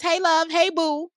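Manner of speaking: high, loud cooing hello; sweet greeting. Retold or imitated. Quoting her AI's greeting to followers, high cooing. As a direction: coo it like texting a friend. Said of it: "a message that says".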